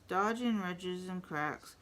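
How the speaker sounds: plain reading voice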